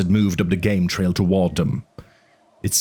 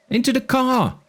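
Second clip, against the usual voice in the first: put-on high, scratchy kid's voice over the radio. A narrator's reading. Boy on the radio orders the doctors into the car, high and scratchy. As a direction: crackle it out like a boy on a bad radio. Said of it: "said a scratchy voice".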